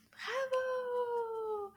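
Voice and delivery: high, long-held cheer; sing-song high bravo; delighted praise. Real, not acted. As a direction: cheer the learner with a stretched final vowel. Cheering the learner on.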